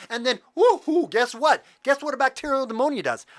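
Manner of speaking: high, excited build to the reveal; pumping himself up mid-rant; fired up. Real, not acted. Ranting to viewers about masks, excited and fired up. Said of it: "I'm getting fired up".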